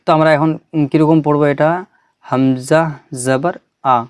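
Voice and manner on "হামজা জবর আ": আ cut short, no drawn-out tail; short final vowel. Shown as a demonstration. Teacher shows students how to read it, short final vowel. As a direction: say the last আ in হামজা জবর আ quickly, without stretching. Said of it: "আমরা টান দেবো না".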